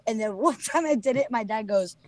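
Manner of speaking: normal talking voice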